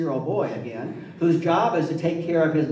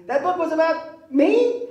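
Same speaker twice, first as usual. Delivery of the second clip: high, louder indignant question as mom; shocked mother; 11 semitones higher, 4 dB louder than usual. Retold or imitated. Mother calls her son after TV show, high surprised voice. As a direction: imitate mom's shocked phone call.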